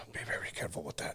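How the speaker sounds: hushed warning; whispering. Real, not acted. Warning co-host, unvoiced.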